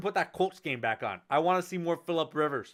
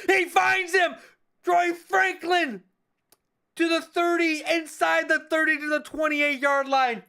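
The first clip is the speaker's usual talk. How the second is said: much higher and louder on the catch; excited call; 6 dB louder, slower than usual. Acted. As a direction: sell the big completion. Completion called live.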